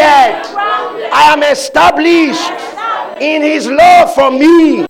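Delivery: high, loud shouted declarations; preaching at full force. Acted. Preacher leading the church in shouted confessions. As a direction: proclaim each line like a battle cry.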